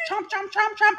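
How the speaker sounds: high, playful chomping sounds; Pac-Man chomping. Pretend. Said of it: "that's what Pac-Man feels".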